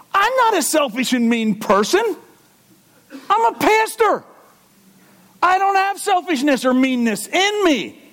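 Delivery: high, mock-pious protest; sarcastic self-righteous pastor voice. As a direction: play the offended holy man. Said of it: "you know what it makes me sound like?".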